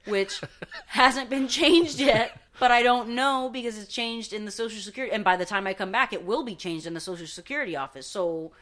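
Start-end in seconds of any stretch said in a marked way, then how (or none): 0.0-5.1 loud laugh breaking into words; laughing in exasperation. Real, not acted. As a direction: laugh it out in disbelief. Laughing at absurd situation.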